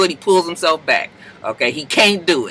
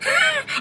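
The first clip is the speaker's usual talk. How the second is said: squeaky incredulous laugh; 6 dB quieter than usual. Retold or imitated. Her face at meditation suggestion.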